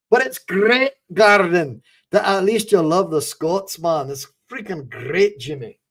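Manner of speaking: put-on Glaswegian with rolled r in great; hearty mock-Glaswegian praise; Glaswegian accent. Thanking a chatter for loving the Scots, in a put-on Glaswegian accent. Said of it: "for my Glaswegian accent".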